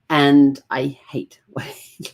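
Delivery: whispered confession through a laugh. Real, not acted.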